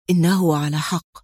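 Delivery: low, understated sadness; sad mumble. A narrator's reading. Friend sadly conceding he is right, sad mumble. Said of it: "غمغم قدري في حزن".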